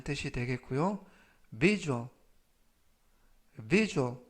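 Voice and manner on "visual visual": visual said as "vizhual"; pronunciation demo. Shown as a demonstration. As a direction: model visual for learners to repeat. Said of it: "visual이라고 발음하시면 되겠습니다". (발음 시범)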